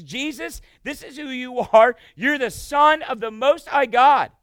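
loud, slow, pleading cry; demon crying out. Acted. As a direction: beg loudly not to be tormented. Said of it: "He goes like this".

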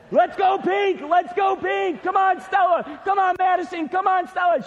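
high, loud sideline yelling; shouted cheering. Retold or imitated. Acts out rival sideline cheering.

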